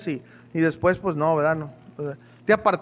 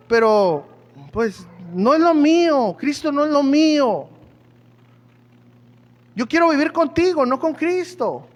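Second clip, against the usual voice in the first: put-on defiant boyfriend; 5 semitones higher than usual. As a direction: push back hard, he won't give up his life. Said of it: "y él le dijo".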